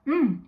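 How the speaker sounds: loud, high mmm of delight; savoring mmm. Real, not acted. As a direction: hum with pleasure at the taste. First bite of the wrap.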